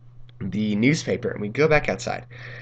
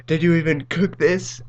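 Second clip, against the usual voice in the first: higher-pitched mock indignation; mom imitation; 6 semitones higher than usual. Friends repeating mom's line as inside joke, mock-indignant. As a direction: lightly mimic mom's incredulous challenge. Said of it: "Every time we talk about my mom".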